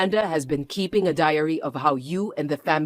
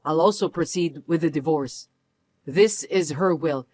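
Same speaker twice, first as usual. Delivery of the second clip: ordinary talk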